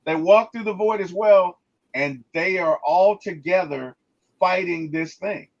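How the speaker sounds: ordinary talk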